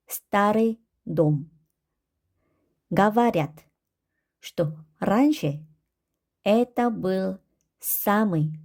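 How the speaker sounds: slow Russian read, word by word; careful, very slow model reading; reading practice. Shown as a demonstration. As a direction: pause between each short phrase for learners. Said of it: "읽기 연습 한번 해보도록 할게요".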